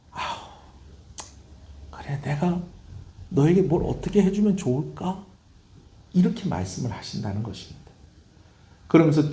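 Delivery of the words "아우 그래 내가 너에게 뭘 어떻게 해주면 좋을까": sighing, held-back question; sigh, then ask at a loss; troubled God. Acted. God to a fickle people, troubled and sighing. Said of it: "이러는 것이 아니고".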